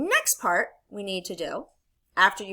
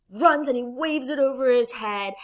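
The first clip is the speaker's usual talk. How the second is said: ordinary talk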